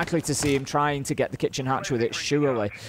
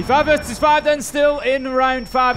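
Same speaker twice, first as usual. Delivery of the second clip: high, loud esports call building to a shout; excited play-by-play; 7 semitones higher, 6 dB louder than usual. Real, not acted. Caster setting up the bedroom attack for viewers, excited play-by-play.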